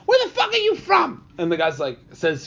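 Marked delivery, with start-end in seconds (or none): 0.1-1.3 high, loud comedian's heckler comeback; shouted stand-up rant, high-pitched; Bill Burr. Retold or imitated. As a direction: yell it at a booing crowd, imitated. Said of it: "Bill Burr's like".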